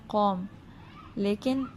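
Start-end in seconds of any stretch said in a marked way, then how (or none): 0.1-0.4 one flat o vowel in "قوم", no glide; everyday qom. Shown as a demonstration. Teacher showing ordinary Urdu "قوم", plain o. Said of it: "عام اصطلاح میں پڑھتے تو".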